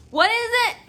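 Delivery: loud, sharply rising question; high mock-Karen disbelief; demanding Karen. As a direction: imitate an affronted customer who can't make it out. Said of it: "Fucking Karen showed up".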